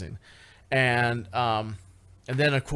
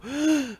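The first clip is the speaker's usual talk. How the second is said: sharp gasp; 3 dB louder than usual. Retold or imitated. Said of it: "is like".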